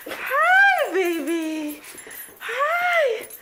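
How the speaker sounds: high squeal with a laugh. Real, not acted. Greeting her pet, baby talk.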